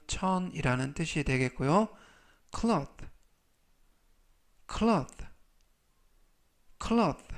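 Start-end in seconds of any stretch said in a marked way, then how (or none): 2.6-7.2 cloth said with clear cl and short o; pronunciation model. Shown as a demonstration. Teacher modelling cloth for learners. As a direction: repeat cloth, rising each time. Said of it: "라고 발음하시면 되겠습니다".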